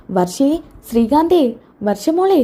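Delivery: high, drawn-out summons; calling out. Acted. Mother-in-law summoning young couple, calling out. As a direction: stretch each name to summon them.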